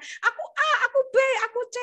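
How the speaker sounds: put-on high childlike voices calling out; children's voices. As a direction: play the kids calling their pick.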